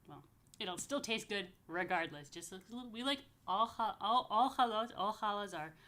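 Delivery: ordinary talk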